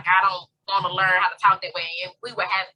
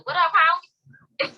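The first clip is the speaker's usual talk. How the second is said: high, slow playful greeting; greeting the bird; 10 semitones higher, 4 dB louder, much slower than usual. Acted. Friend greets a bird in her yard, high playful call.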